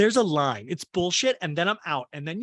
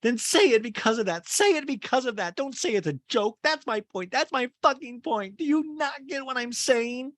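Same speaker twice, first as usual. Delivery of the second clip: heated, high-pitched mock shouting; Kyle screaming; 7 semitones higher than usual. Kyle screaming at Sutton in Aspen, imitated high yell. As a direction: hammer each demand, voice pitched up. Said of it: "Kyle screaming at Sutton in this scene".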